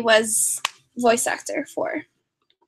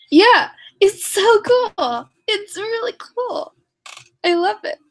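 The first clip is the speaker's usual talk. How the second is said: gushing excitement; 7 semitones higher, slower than usual. Real, not acted. Gushing slowly over signed item.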